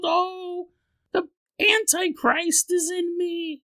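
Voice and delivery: high shrieking panicked woman about to give birth; screaming woman. Acted. Patient Natalie screaming at staff, high panicked shriek. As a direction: shriek it like a madwoman in labor. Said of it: "You hear this woman screaming".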